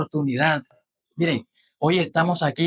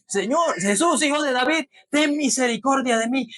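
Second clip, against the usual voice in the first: crying out; 8 semitones higher than usual. Acted. Bartimeo crying out to Jesus.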